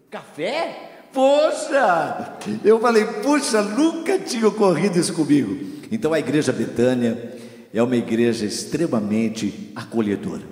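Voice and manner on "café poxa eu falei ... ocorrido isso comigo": surprised delight. Retold or imitated.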